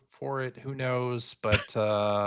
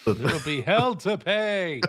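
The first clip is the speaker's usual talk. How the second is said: slow, higher mock-dramatic delivery; hammed-up threat; 5 semitones higher, 4 dB louder, slower than usual. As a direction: draw the threat out with playful menace.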